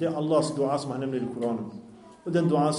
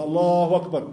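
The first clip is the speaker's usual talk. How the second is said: a mildly raised call of Allahu Akbar; raised voice dhikr; 4 semitones higher, 4 dB louder than usual. Retold or imitated. A teacher retells companions raising their voices in dhikr, mildly loud. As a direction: call it out a bit louder, as in retold dhikr. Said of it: "höjde rösten med".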